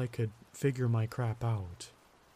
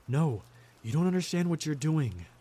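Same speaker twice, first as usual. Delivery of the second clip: higher-pitched, urgent protest; pitched-up plea of protest; desperate narrated cry; 4 semitones higher than usual. A narrator's reading. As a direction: plead desperately, short of a full shout. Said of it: "I cried".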